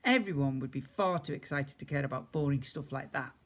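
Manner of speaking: plain reading voice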